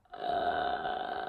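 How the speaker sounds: creaky, creepy-door fry, very low; vocal fry. Shown as a demonstration. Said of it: "sound of a vocal fry ... something like this".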